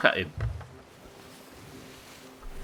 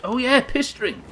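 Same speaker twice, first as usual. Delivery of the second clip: excited realisation; 12 semitones higher, 4 dB louder than usual. Real, not acted. Sudden realisation in game.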